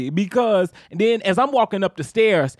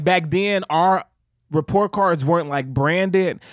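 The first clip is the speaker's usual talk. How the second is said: ordinary talk, nothing marked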